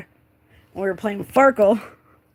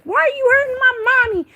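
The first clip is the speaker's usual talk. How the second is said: put-on very high, loud child's cry; small child confronting an attacker, pitched way up; young son's voice; 6 dB louder than usual. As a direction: imitate a little boy shouting in protest. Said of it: "my son had woke up".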